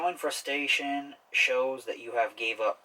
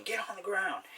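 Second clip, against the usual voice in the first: angry robber command; 9 semitones higher, 3 dB quieter than usual. Pretend. Robber with a gun ordering the customers down, sharp mock anger. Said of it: "That's like an angry thing".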